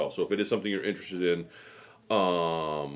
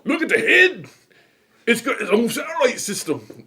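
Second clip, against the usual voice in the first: much higher, louder hammed-up Scottish accent; 14 semitones higher, 7 dB louder than usual. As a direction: belt it out in a mock Scottish brogue, high and loud. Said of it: "when I started doing my Scottish accent".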